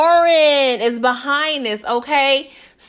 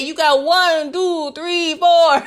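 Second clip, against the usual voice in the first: higher, playful counting-puppet voice; Count Dracula; 5 semitones higher, slower than usual. Retold or imitated. Mimicking Davis tallying bodies, Sesame Street counting voice. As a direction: count each number out with relish, higher. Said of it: "Back to Sesame Street".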